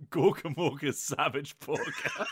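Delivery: usual speaking voice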